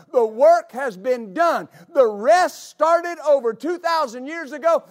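fiery preaching. Real, not acted. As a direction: preach it out with full force. Preaching at full force.

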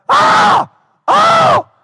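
high, loud shrieking scream in a put-on voice; imitated wail. Preacher imitating a big deputy's high scream at a spider. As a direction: shriek like a terrified lady. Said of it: "I heard this wail beside me".

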